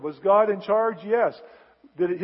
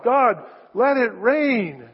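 slow, louder pleading plea; begging prayer; 4 semitones higher, 6 dB louder, slower than usual. Acted. Elijah begging God for rain, slow and pleading. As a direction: drag it out and implore. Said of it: "and he begs God".

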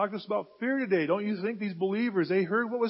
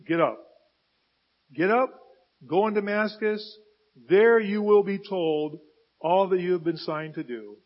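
divine command; 7 dB louder than usual. Acted. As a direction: command firmly, phrase by phrase. God's command to Saul, acted.

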